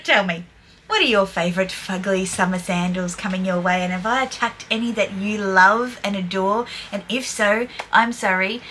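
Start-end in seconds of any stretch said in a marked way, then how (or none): none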